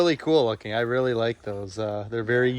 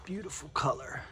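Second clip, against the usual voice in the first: whispering; 12 dB quieter than usual. Real, not acted. Whispered aside while filming.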